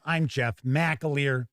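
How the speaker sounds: normal talking voice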